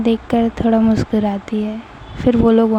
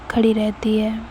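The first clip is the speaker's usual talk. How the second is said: usual speaking voice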